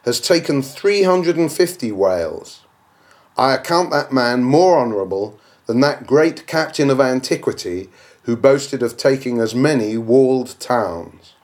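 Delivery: plain reading voice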